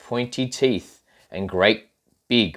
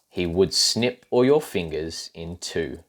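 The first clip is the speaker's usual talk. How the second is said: ordinary delivery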